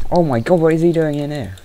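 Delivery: panicked shout at a mob in the house; startled cry. Real, not acted. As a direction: jump at the sudden intruder.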